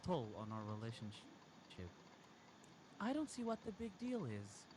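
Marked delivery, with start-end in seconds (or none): 3.0-4.7 a much higher, lightly voiced girlfriend; higher put-on voice for the girlfriend; girlfriend's voice. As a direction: lift into her voice for the quote. Girlfriend's line voiced in story.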